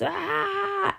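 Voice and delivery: high, drawn-out ah; monster scare cry. Acted. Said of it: "the monster comes and goes".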